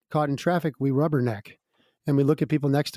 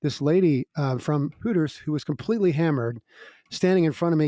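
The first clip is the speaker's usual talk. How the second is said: normal talking voice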